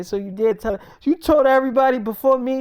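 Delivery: retold reproach. Man confronting girlfriend who told everybody first, loud reproach. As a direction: call her out with rising volume.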